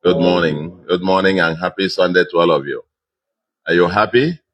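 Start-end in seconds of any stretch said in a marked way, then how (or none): none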